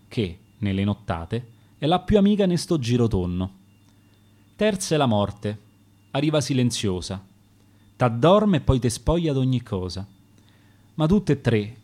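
ordinary delivery, nothing marked

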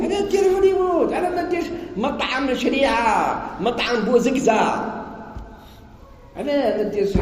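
very high incredulous mockery; mock the owner's choice in disbelief; high mocking questions about the restaurant name; mocking incredulity.

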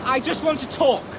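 shouted call after someone walking off. Acted. Philip calling after Calgary to stop him leaving, shouting.

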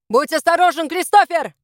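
high, loud warning to a friend; shouted warning. A narrator's reading. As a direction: call out urgently to be careful. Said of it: "крикнул Доминик".

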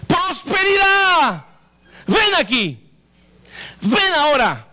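stretched commanding call; calling prosperity. Shown as a demonstration. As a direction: call prosperity like a distant friend. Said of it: "usted le dice".

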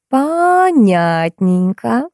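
high, very slow drawn-out word; drawled. A narrator's reading. Prop woman reacts to newcomer's introduction, drawling. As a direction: stretch each syllable knowingly. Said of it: "протянула Алиса".

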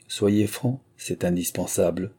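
usual speaking voice

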